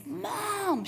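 high, excited call of Mom; daughter calling. Retold or imitated. Daughter phoning her mother, high excited call. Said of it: "Kristen, says".

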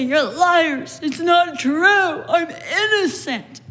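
a hint of a shouted denial, slowing at the end; mock indignant protest. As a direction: protest innocence, half-hearted mock shout. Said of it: "He doesn't defend himself of the charges, say".

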